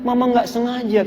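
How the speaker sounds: small, soft, pleading wife; acted weepy plea, kept quiet; mock tearful apology. Wife begs husband's pardon at his feet, mildly tearful. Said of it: "jatuh bersujud di kaki suaminya nangis".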